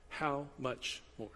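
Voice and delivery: soft reflective. Shown as a demonstration. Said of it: "this is the phrase".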